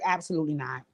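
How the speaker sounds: low, soft, firm "Absolutely not."; soft low-voiced refusal, imitating Drea; quiet retold refusal. Retelling Drea's firm refusal.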